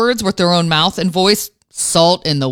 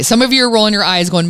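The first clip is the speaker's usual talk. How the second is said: ordinary talk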